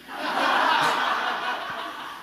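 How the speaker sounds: breathless unvoiced laugh; wheezy laugh. Real, not acted. Preacher laughs at the pastor's answer, breathless laugh.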